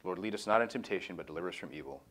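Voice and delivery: a hushed, reverent prayer that fades toward the end. Acted. Leader closes the study by praying for the group, hushed and reverent.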